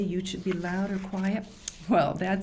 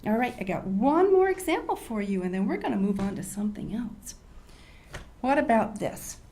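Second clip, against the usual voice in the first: ordinary talk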